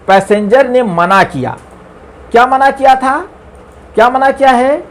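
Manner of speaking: normal talking voice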